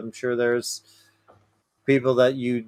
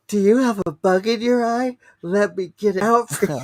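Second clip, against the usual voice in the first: higher, creepy stranger voice; 7 semitones higher than usual. Acted. Teasing offer to remove bug.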